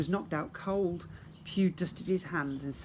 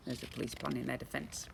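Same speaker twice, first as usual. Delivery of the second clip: quiet aside; 3 semitones lower, 8 dB quieter, faster than usual. Real, not acted. Aside to child listener.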